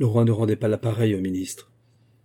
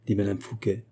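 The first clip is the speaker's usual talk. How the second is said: plain reading voice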